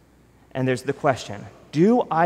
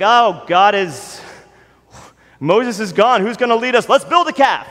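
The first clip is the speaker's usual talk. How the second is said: higher and louder, climbing in mock panic; panicky Israelites; 7 semitones higher, 7 dB louder than usual. Israelites whining that Moses is gone, mock panic. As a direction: ham up a crowd losing its nerve. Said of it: "they're like".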